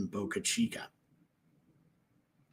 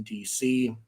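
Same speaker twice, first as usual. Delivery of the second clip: ordinary delivery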